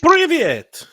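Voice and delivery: loud, high mock Russian greeting; mock Russian accent. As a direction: burst out in a hammy Russian accent. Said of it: "worst fucking accent I've ever heard".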